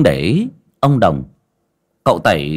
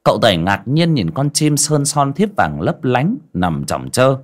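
ordinary delivery, nothing marked

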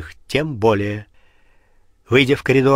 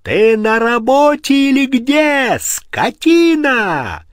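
furious yell, much higher and louder; berserk rage; 12 semitones higher, 7 dB louder, slower than usual. Acted. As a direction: scream it in blind fury. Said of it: "в бешенстве заорал".

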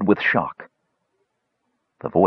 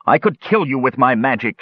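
mild shout, higher; 5 semitones higher than usual. A narrator's reading. Kishpa threatens Tanis, mild shout. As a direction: threaten him with raised voice. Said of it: "shouted Kishpa".

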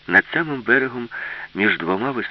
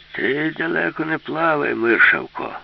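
put-on slow, higher grumble of an old grandpa; grumbled; 7 semitones higher, 3 dB louder, slower than usual. A narrator's reading. Old robot warns Alisa in the water, grumbling. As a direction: grumble it out, drawing the words out. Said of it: "буркнув дід".

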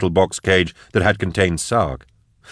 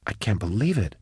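much softer, stunned near-whisper; 2 semitones higher, 7 dB quieter than usual. Acted. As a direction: breathe it out in disbelief. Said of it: "Max whispered".

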